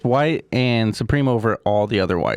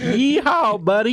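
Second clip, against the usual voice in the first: very high southern cowboy whoop; mock southern accent; 13 semitones higher, 3 dB louder, slower than usual. Echoing friend's cowboy joke, mock southern whoop. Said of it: "gave them a southern accent".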